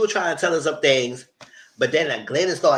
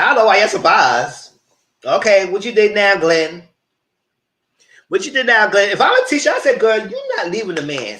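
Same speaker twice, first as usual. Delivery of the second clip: loud, higher-pitched sassy retelling; retold loud challenge; 4 semitones higher, 8 dB louder than usual. Speaker telling Glenn and friend what she said, loud challenge. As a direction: call it out loud as if confronting them.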